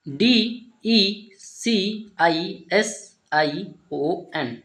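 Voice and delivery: slow letter-by-letter spelling of डीईसीआईएसआईओएन. Shown as a demonstration. Teacher spelling the word for students, slow and letter by letter. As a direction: spell each letter out slowly, one by one. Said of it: "इसको पढ़ेंगे decision".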